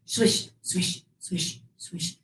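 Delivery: breathy swishing through grass, softly; whispered swish sounds. Shown as a demonstration. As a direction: brush the words like grass. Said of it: "Can you do that?".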